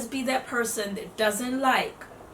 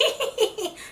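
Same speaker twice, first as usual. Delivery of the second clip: high, quick mock chipmunk giggle; chipmunk laugh; 11 semitones higher than usual. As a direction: imitate a squeaky chipmunk laugh. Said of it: "She got the chipmunk laugh".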